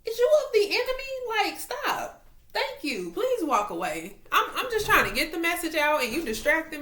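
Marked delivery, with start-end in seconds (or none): 0.1-2.8 very high, squeaky scolding; high-pitched mock-suspicious whine; playful accusation. Real, not acted. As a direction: squeal it at the dog in exasperation.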